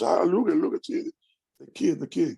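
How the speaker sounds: an uncle cooing over a little drummer; imitating proud uncle. Uncle cheering on his young nephew, high and delighted.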